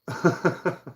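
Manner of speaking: short high chuckle; brief bubbling chuckle; real laugh. Real, not acted. Streamer amused by a chatter's name, chuckling.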